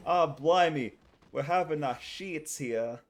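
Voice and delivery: loud mock British exclamation, then slow; joke British accent. Streamer keeping up the British bit mid-game. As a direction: ham up a cartoon British accent. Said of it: "You want me to do a British impression?".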